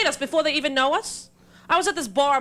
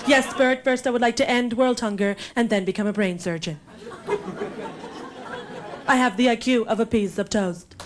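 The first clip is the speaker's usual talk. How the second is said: mock pageant contestant answering the host; Miss America contestant; 3 semitones lower than usual. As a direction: recite a beauty-queen answer with careful, accent-free diction. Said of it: "like a contestant in the Miss America pageant".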